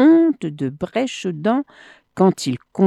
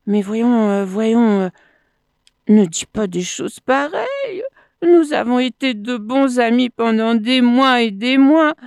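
raised-pitch coaxing; pleading; 6 semitones higher than usual. A narrator's reading. Crocodile appeals to months of friendship, pleading. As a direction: coax him back, voice lifted.

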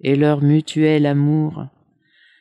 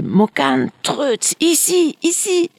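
high calls for the dogs, climbing higher; calling out; 10 semitones higher than usual. Acted. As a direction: call the dogs back, pitch rising each word. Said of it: "Et il hurlait".

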